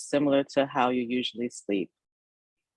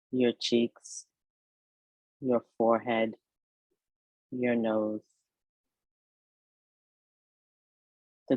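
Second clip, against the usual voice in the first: guided meditation; 2 semitones lower than usual. Acted. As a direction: ease the listener toward rest, phrase by unhurried phrase. Leads a yoga nidra meditation.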